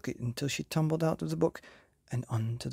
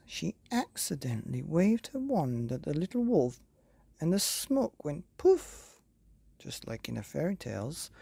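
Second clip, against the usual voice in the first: usual speaking voice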